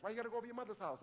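rapid, soft accusing quote; fast hushed imitation of a suspicious husband; mock jealous complaint. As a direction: rattle off the jealous question quietly. Acted argument with wife.

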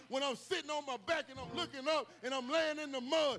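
rising sermon cry of trust; preaching at full force. Acted. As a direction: whoop it up like a preacher at the peak. Preacher builds to a climax.

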